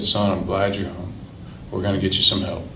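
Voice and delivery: a hint of dad's quiet tenderness; tender father. Father welcoming his son home, softly tender.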